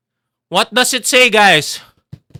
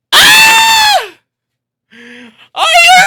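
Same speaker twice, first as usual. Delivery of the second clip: piercing high, loud scream; excited screaming; 5 dB louder, much slower than usual. Real, not acted. Streamer hyping viewers for reveal, screaming. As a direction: shriek it with words drawn out.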